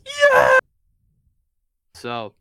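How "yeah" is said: very high, loud celebratory shout; short, full-volume imitation of a victory cry; imitated triumphant yell. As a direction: yell it like a commentator at the final buzzer. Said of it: "he just yells".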